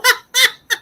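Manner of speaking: sudden high laugh, loud; high burst of laughter. Real, not acted. Laughing at her own Charlie Brown joke, loud high laugh. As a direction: let a big high laugh out.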